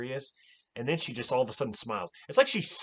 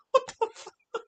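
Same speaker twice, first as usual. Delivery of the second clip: laughing disbelief. Real, not acted. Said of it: "laughing disbelief".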